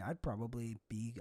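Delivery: normal talking voice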